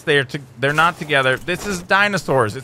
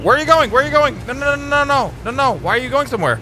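high alarmed cries of no, no, no; a creature owner panicking as the babies walk away; panicked calling; 10 semitones higher, faster than usual. Real, not acted. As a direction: call after them in a rising panic. Babies wander off.

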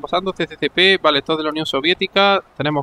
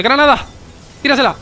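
grenade warning yell; 5 semitones higher than usual. Real, not acted. Grenade spotted, urging throw.

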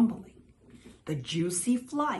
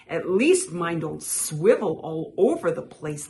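usual speaking voice

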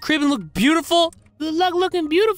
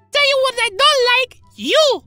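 sassy rejection; 11 semitones higher, 4 dB louder than usual. Acted. Woman rejects suitor.

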